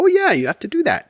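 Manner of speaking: high imitated "oh, yeah" of recall; lightly voiced wife; wife, suddenly remembering. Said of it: "she would think of it and go".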